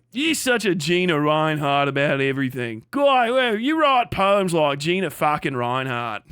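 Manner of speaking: broad, hammed-up Aussie insult; exaggerated Australian impression; mock Australian accent. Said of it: "Isn't that what you people say?".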